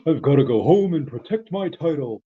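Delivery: a hint of Patrick's goofy line delivery; Patrick impression. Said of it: "It's Patrick".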